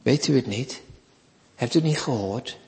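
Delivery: hushed rhetorical challenge; soft pressing questions. Acted. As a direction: lean in quietly and press the point.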